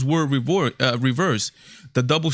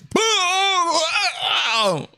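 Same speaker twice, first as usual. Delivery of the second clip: mock flop cry. Vlogger imitating a player faking a foul, hammed-up yelp. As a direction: ham up a player's fake injury yelp. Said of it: "He goes".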